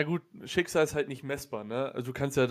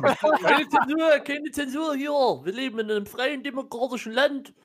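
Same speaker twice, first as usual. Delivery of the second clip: mock protest shout; 9 semitones higher, 4 dB louder than usual. Mock protest against censoring.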